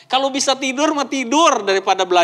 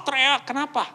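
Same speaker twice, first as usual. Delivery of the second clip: usual speaking voice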